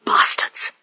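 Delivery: hissed under the breath; whispered curse. Acted. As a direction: spit it out in a whisper.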